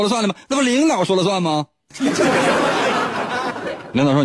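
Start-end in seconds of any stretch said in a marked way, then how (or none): 1.9-3.9 airy falling giggle; wheezing high laugh; breathy high laugh. Real, not acted. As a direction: giggle and let it fall. Laughs at own joke.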